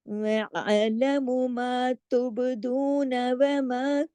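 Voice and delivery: slow, drawn-out melodic tajweed recitation; Quran recitation. Shown as a demonstration. Teacher recites verses for students, slow melodic chanting. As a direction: chant each word slowly with long vowels.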